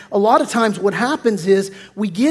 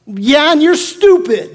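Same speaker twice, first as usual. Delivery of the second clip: put-on childish retort; 5 semitones higher, 5 dB louder, slower than usual. Lashing out at criticism.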